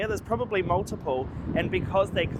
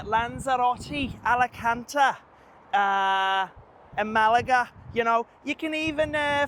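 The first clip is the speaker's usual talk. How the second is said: put-on much higher, chatty Scouse accent; 9 semitones higher than usual. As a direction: play a cheeky Liverpool lad in Scouse. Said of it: "give you my best Scouse accent".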